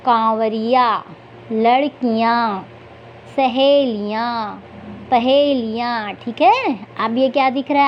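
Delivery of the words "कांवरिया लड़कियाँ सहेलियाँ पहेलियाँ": drawn-out model words, each on its own; teacher's slow word list with pauses between words; reading practice. Shown as a demonstration. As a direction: read word by word for young learners, nasal vowels stretched. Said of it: "तो चलिए पढ़ते हैं".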